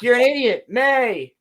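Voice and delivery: high, loud pleading calls of her name; hammed-up cry as she walks away; mock desperate yelling. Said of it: "And he's like".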